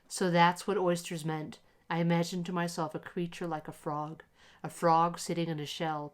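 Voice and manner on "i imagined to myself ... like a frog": ordinary delivery, nothing marked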